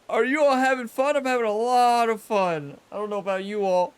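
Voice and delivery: high, loud sarcastic cheer about having fun; mock cheerful. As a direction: pretend you're loving it through gritted teeth. Sarcastic cheer after bad hole.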